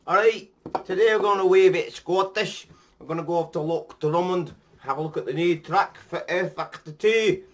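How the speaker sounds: a self-mocking Scottish accent he can't really do; bad Scottish accent. Vlogger opening his video in a mock Scottish accent. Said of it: "I'm gonna quit doing this Scottish accent 'cause I'm no good at it".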